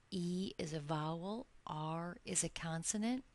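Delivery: ordinary talk, nothing marked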